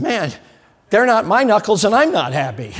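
irritated retort. Retold or imitated. Preacher retorts to the grinning mechanic, exasperated. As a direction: snap back, exasperated.

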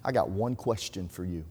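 low, soft, measured challenge; quiet confrontation. Retold or imitated. Rabbi walks up to the brother, quiet confrontation. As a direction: lightly voice a grave, hushed rabbi.

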